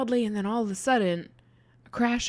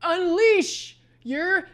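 high, loud, slow drawn-out war cry; rally the troops with a long battle cry; 6 dB louder, much slower than usual. Retold or imitated. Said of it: "Rudy goes".

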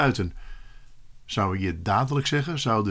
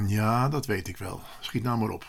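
softer, lower inner grumble; disrespectful thought; 3 semitones lower, 7 dB quieter than usual. A narrator's reading. Pupil silently answering back to his teacher, disrespectful. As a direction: think it irreverently, under the voice. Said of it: "niet erg eerbiedig".

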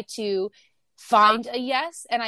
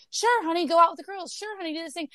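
higher, easygoing sure-honey voice; agreeable partner; 9 semitones higher, faster than usual. Retold or imitated. A partner happily letting their spouse go out. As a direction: breezily say yes to everything like a pushover. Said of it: "If your partner's always like".

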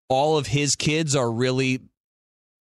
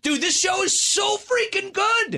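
excited shout. Real, not acted. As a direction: burst out with fan excitement. Gushing about favourite show.

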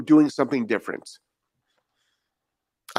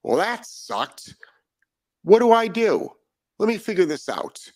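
lightly voiced dog, puzzling it out, jumping higher; dog's inner voice; 7 semitones higher than usual. Trainer voicing a corrected dog working out what to do, lightly voiced. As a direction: play the dog thinking it over, half-mocking. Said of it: "And you know what dogs do?".

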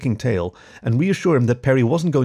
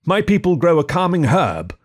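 a hint of a proud barbarian, a bit louder; 7 semitones higher, 4 dB louder than usual. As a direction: porcupine in character miming a smoke. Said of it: "the porcupine mimed".

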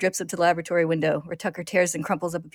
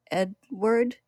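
chimera parroting; 4 semitones higher than usual. A narrator's reading. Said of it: "it repeats".

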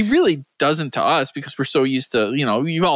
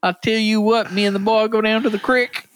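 higher, folksy Southern twang; mock Southern accent; 5 semitones higher than usual. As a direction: put on a country Southern accent. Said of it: "I'm just a little Southern belle".

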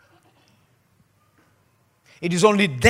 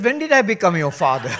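a laughing, raised question; laughing retort; 5 semitones higher, 9 dB louder, faster than usual. Retold or imitated. Pastor laughing at the man calling him father. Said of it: "I said".

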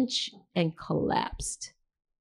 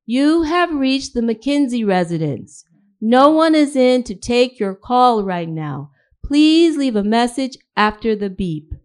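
formal outgoing message, higher and louder; formal answering-machine voice; 4 semitones higher, 7 dB louder than usual. A narrator's reading. Mother on her answering machine greeting callers, formal and raised voice. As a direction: recite it loudly, like a recorded phone greeting. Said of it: "say in a formal voice".

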